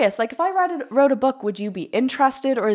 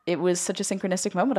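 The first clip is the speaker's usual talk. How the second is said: normal talking voice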